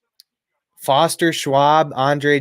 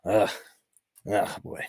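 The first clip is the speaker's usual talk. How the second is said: breathy whispered groan of dismay; real sinking dismay, whispered; 5 semitones lower, 8 dB quieter than usual. Real, not acted. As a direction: let it out under your breath. Opponent gains big yardage.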